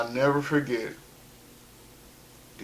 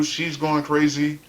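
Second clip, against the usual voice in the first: plain reading voice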